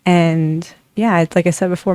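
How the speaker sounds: usual speaking voice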